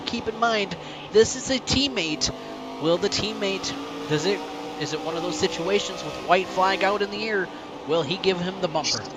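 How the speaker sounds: excited play-by-play building to the finish; live race call. Real, not acted. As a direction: call the final laps with rising hype.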